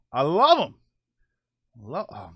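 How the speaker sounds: ordinary talk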